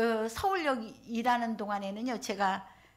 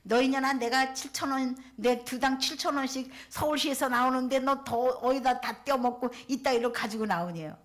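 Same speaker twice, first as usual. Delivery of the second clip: loud mock rant of a drunk man; angry accusation; 4 semitones higher, 5 dB louder than usual. Drunk man accusing the volunteer of skimming money, loud angry shout. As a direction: bark out the accusation. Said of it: "이러는 거예요".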